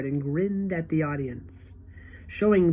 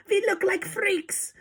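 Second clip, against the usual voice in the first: mock dismay. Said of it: "Another shouts".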